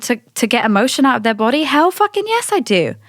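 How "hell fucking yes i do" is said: emphatic yes. Real, not acted. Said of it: "emphatic answer to own question".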